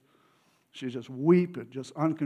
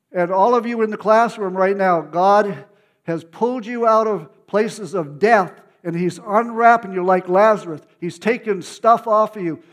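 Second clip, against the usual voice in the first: higher and much louder, proclaiming with force; prophetic declaration; 5 semitones higher, 8 dB louder than usual. Acted. Preacher declaring God's word to the whole classroom, loud proclamation. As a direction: thunder it out like a prophecy over them. God speaking through him to class.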